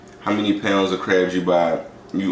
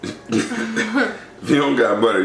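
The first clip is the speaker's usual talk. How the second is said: laughing; 7 semitones higher, much slower than usual. Real, not acted.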